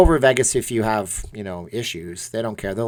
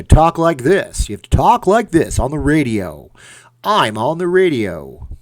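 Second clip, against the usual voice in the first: louder, put-on DJ voice with a higher pitch; radio announcer voice; 4 semitones higher, 7 dB louder than usual. Shown as a demonstration. Streamer showing chat what a radio voice sounds like, hammed-up DJ. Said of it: "Do I sound artificial?".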